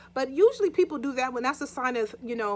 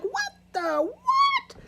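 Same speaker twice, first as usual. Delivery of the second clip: startled what; 3 dB louder, much slower than usual. Retold or imitated. Husband woken by blow, startled.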